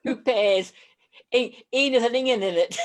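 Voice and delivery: broad Dundee Scots asked for by the host; broad Dundee accent. Shown as a demonstration. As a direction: say it like a true Dundonian. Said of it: "tell us how you would say".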